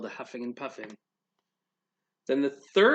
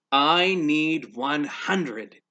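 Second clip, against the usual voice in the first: slow emphatic; 5 dB louder, much slower than usual. Acted. Pig states his need.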